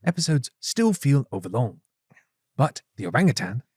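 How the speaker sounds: documentary-style British delivery; David Attenborough. Acted. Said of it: "I was going for David Attenborough".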